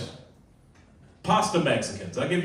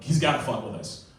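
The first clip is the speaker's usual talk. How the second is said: usual speaking voice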